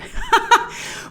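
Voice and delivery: very high burst of laughter. Real, not acted. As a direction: laugh at your own joke. Laughs after mock villain.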